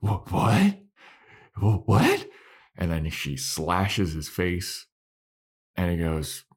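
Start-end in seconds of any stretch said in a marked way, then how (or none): 0.0-2.3 stammered, rising disbelief; louder, upswept retold surprise; mock bewilderment. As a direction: act out a stunned double take. Retold Willow's stunned reaction.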